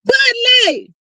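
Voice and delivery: high, loud, falling cry of disbelief; incredulous exclamation. Real, not acted. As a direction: exclaim it as if you can't believe it. Incredulous at the friend's beer.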